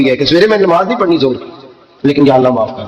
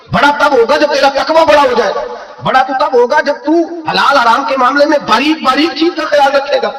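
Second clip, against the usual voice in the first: high, fervent preacher's cry; fiery preaching; 11 semitones higher than usual. Acted. Preacher scolding the congregation about taqwa, fiery high preaching. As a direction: rebuke them from the pulpit at full pitch. Preacher rises to full force.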